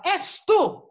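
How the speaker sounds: distraught prophet crying out the accusation; troubled outcry. Retold or imitated. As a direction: blurt out the accusation, shaken. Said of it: "o Tiresias fica perturbado e fala".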